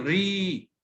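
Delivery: ري with a long, held "ee"; long vowel. Shown as a demonstration. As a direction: stretch the vowel in ري. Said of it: "يبقى هذا صوت طويل".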